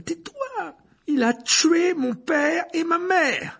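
high, slow, furious roar. Acted. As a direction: hurl the accusation at him. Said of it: "rugit Harry".

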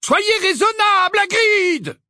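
loud, high-pitched shout; shouted plea. A narrator's reading. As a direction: call out urgently across the park. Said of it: "Un homme s'écria".

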